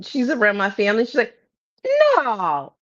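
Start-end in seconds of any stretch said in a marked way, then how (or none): 1.8-2.7 high-pitched mock Southern accent. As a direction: gush in a put-on Southern accent. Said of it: "fake a Southern accent".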